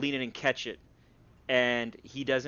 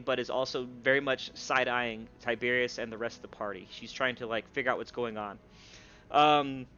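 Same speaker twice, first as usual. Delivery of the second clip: normal talking voice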